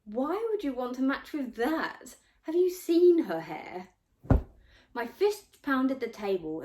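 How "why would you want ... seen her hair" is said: much higher and louder sneer; mock her to the whole room; a girl sneering at a rival's hair, much higher and louder; scornful mockery. A narrator's reading. Pupilla mocks the narrator.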